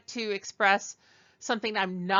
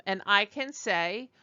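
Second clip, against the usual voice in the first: normal talking voice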